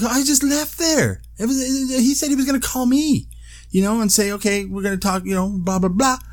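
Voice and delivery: high, frantic rush of worry; panicked. Retold or imitated. Retelling the shock, high and panicked. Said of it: "'Cause I'm like".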